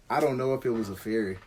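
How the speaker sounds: usual speaking voice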